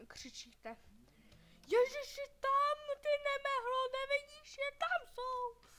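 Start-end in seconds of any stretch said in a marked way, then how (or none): 1.7-5.7 high, loud, scolding shout, slowing at the end; loud exasperated telling-off. Real, not acted. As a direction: scold the clumsy character loudly. Scolds own character.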